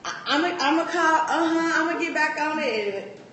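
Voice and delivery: playing her own empty promise to herself; self-talk promise. Telling herself she'll get back on it, high and eager. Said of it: "I keep saying to myself".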